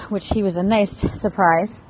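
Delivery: ordinary talk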